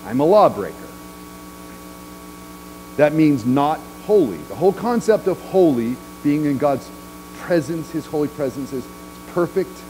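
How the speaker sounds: ordinary talk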